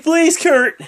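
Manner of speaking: mock pleading whine; whiny plea. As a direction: beg like a crybaby. Miz crying, pleading.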